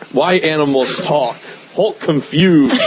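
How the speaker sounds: slow, lightly voiced puzzled brute; Hulk. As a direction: imitate a baffled monster, breaking into amusement. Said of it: "the Hulk is like".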